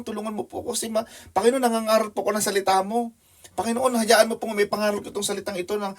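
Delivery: high, pleading cry to God from the treetop; desperate prayer. Acted. Missionary up a tree with a python begs God for help, high and pleading. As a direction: beg the Lord for rescue, voice pitched high.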